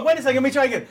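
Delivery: ordinary delivery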